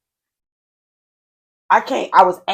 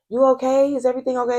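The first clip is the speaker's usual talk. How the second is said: lightly voiced old neighbor, higher; a hint of a worried old lady asking at the door; concerned neighbor; 8 semitones higher than usual. Neighbor knocking at 3am.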